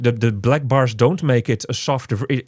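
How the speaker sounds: ordinary talk, nothing marked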